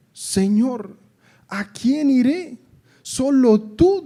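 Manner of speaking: high, loud, slow cry to the Lord; pleading Peter. Acted. Simon Pedro answering Jesus, high and loud pleading. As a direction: beg him as if nothing else remains.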